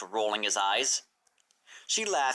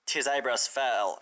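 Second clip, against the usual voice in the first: usual speaking voice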